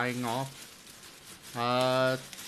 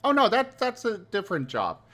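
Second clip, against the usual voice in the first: high character voice; 8 semitones higher, faster than usual. Acted.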